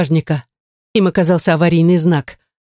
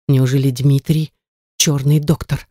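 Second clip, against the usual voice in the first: plain reading voice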